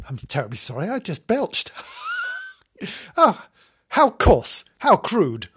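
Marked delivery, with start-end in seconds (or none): 1.8-2.5 high squeak of a laugh; a tiny embarrassed squeal; a high giggle after a belch; squeaky giggle. Real, not acted. 3.2-5.5 a prim, louder mock-gasp at herself; a hint of a shocked old lady. Mock scolding herself after belch.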